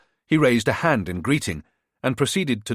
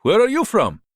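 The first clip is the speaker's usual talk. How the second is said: put-on higher-pitched, smooth-talking hustler with a foreign accent; charming border fixer; 12 semitones higher, 5 dB louder than usual. A narrator's reading. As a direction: sell yourself like a friendly tout. Bogdan's held voice.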